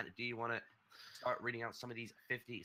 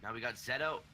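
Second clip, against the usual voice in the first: play-by-play; 4 semitones higher, 3 dB louder than usual. Acted. Live match commentary.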